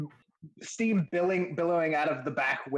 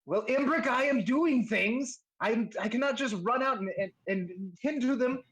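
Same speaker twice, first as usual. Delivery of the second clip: high-pitched protesting character voice; shopkeeper answering back; 7 semitones higher than usual. Acted. Busy smith answers partner from the back, protesting. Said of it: "you hear a voice answer back".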